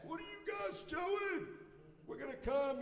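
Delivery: high, thin far-off shout; distant yell back. Retold or imitated. Short golfer shouting back down the course, mock distant yell. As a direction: imitate an angry golfer yelling from afar. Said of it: "He would yell back".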